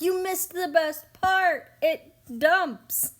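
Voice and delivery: high, loud and slow, thrilled about the dump; imitating an excited boy who loves the dumping; excited kid. Son telling mom she missed the dump, excitedly loud. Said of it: "He's like".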